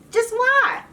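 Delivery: "Just why?" flung up high in disbelief; a high, incredulous "why"; exasperated. Real, not acted. Vlogger asking absent Janelle, incredulous high pitch.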